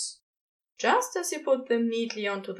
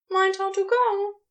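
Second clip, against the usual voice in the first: higher, fussy angel calling after him; Aziraphale calling out; 9 semitones higher, 4 dB louder than usual. A narrator's reading. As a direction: call after him, pitched up. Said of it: "Aziraphale called out".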